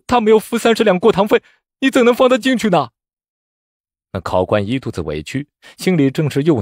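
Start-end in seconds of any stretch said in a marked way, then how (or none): 0.1-2.9 high-pitched indignant outcry; loud protest. A narrator's reading. Poor candidate protesting to the examiner, loud and indignant. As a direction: call out the unfairness at the top of your voice. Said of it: "大声道".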